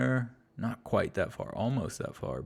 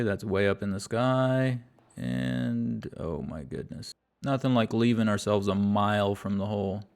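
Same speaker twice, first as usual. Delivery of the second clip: normal talking voice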